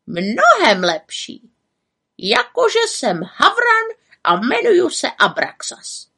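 slow, teasing raven voice; knowing raven. A narrator's reading. Raven teases the witch with a riddle, slow. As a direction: drag it out, hinting at a secret.

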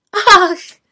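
high, loud ah of realisation; sudden realisation. A narrator's reading. Little witch slaps her forehead, realising. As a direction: cry out as it dawns on you. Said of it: "zvolala malá čarodějnice".

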